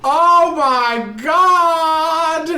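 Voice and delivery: high, loud, very drawn out; mock horrified wail. Reacting to the mom in the shadow, long mock-horrified wail. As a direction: stretch out a horrified viewer's shout. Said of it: "And I was like".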